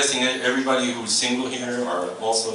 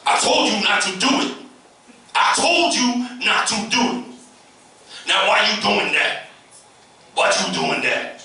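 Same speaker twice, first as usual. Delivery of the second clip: mock angry parent yelling; 10 semitones higher, 7 dB louder than usual. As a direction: bellow at the child. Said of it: "the second type of parent is".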